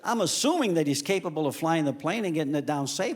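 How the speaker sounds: usual speaking voice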